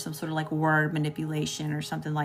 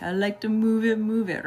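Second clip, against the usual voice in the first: sing-song lemur voice, pitched up and louder; Madagascar lemur impression; 5 semitones higher, 4 dB louder than usual. Reader to viewers, imitating the Madagascar lemur, sing-song and high. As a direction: bounce it out like the party lemur. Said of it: "You know how he's like".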